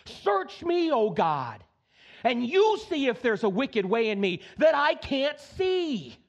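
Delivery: slow pleading prayer to God; earnest prayer. Retold or imitated. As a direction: cry it out as a humble plea. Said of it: "when he cries out to God".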